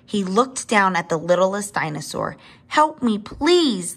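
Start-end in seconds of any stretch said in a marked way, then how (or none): 2.7-3.9 high, pleading call for help; crying out. A narrator's reading. Littlest dinosaur begging the long neck, crying out. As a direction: beg in a raised, high voice. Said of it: "the littlest dinosaur cried".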